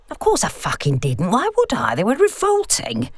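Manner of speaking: a wry mother, mildly put out; dry elderly mum. Acted. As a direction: tease like a sharp old mum. Mum answers on phone.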